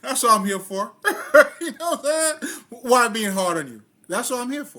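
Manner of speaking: high, loud laughing talk; talking high and loud while laughing. Real, not acted. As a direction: laugh through the joke. Jokes about being the doctor.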